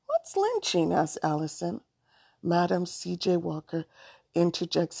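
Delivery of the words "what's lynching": puzzled question. Acted. Child asks puzzled question.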